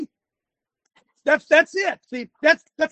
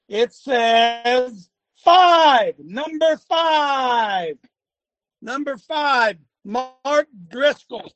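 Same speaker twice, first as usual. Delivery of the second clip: slow; much slower than usual. Real, not acted. Reading chart slowly.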